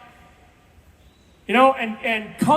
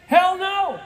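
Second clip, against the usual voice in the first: higher, louder, a scornful burst; emphatic hell no; 6 semitones higher, 4 dB louder than usual. Real, not acted. Speaker to the crowd, answering his own question with a loud scoff. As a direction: slam the answer down hard.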